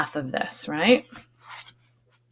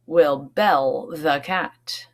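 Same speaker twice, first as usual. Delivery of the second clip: slow wise question; 2 semitones lower, much slower than usual. Acted. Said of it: "a wise old mouse spoke up".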